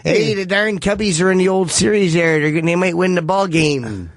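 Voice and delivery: broad put-on Chicago accent; hammed-up Chicago regular-guy accent. Shown as a demonstration. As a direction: do a Chicago sports fan accent. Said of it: "I do my Chicago accent thing".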